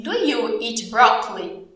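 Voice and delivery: slow but connected teacher question; slow model question. Shown as a demonstration. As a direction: ask it slowly in one piece. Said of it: "É uma pergunta". (pergunta lenta, sem pausas)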